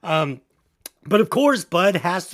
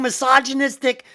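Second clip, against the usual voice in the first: ordinary talk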